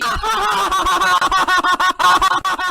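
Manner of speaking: high, loud booming belly laugh; loud mocking laugh. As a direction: burst out laughing at him. Gordon mocks Thomas as hero.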